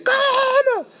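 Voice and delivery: very high mock shriek; hysterical brother. Brother hears the car is wrecked, hysterical shriek. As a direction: screech in disbelief, stretched out. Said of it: "Pues histérico".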